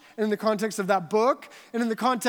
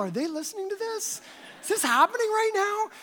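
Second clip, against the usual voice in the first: higher, hushed panic at first; pitched-up can't-believe-it thought; disbelieving inner voice; 8 semitones higher, 4 dB quieter than usual. Retold or imitated. Inner panic at overheard drama.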